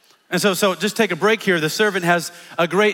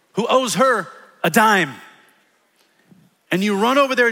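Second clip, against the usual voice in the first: ordinary talk, nothing marked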